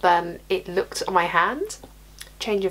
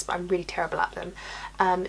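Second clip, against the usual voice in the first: normal talking voice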